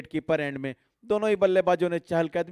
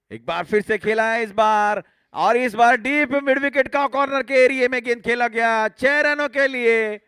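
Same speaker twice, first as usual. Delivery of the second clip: high, loud boundary call; excited cricket commentary; 6 semitones higher, 5 dB louder than usual. Real, not acted. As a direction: call the six with rising thrill. Six hit in live commentary.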